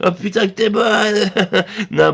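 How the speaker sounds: ordinary talk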